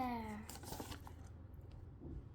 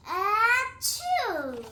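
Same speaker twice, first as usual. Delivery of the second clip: big loud acted achoo; loud sneeze; 5 semitones higher, 11 dB louder than usual. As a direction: sneeze it out hard. Gerald can't stop sneezing.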